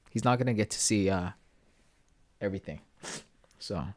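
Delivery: real crying. Real, not acted. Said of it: "I never thought I'd be crying on stream".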